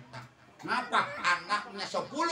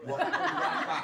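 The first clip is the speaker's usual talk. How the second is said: shocked father; 6 dB louder, much slower than usual. Retold or imitated. Father hears son wants marriage.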